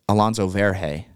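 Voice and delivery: "Verge" said as two syllables, vair-HAY; misread surname. Retold or imitated. Host quoting a friend's version, "Verge" as vair-hay. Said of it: "friend of the podcast, uh, Drew Rawlings said".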